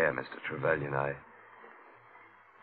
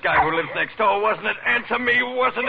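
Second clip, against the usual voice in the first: jealous shout; 11 semitones higher than usual. Acted. Husband shouts at his wife over Jerry Boyd. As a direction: force her to answer, rising to a roar.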